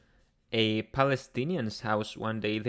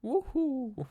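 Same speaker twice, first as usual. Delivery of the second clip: very high cartoon Mario woohoo; high, gleeful Mario cry; 14 semitones higher than usual. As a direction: squeal it like Mario jumping. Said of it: "Mario sound".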